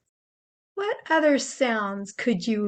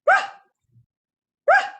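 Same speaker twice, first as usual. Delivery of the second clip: breathy dog ruff; 7 dB louder than usual. Shown as a demonstration. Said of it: "Let a lot of air out while you're saying it".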